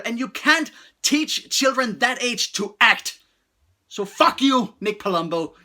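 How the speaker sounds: loud, high-pitched angry rant; real outrage. Real, not acted. Reviewer berating the director for exposing toddlers, outraged. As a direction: let the anger burst out at the culprit. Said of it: "I'm getting so mad".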